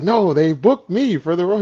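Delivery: very high, loud disbelieving excitement; excited insistence. Retold or imitated. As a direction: insist on the big news to a friend. Retold excited insisting on news.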